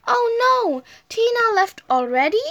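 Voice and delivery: high-pitched exclamation, lightly shouted; mild shout. A narrator's reading. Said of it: "shouted Spotty".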